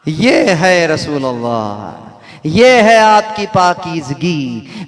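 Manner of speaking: low, slow, drawn-out praise; reverent preaching. Real, not acted. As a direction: solemnly stretch each phrase in reverence. Solemn praise of the Prophet.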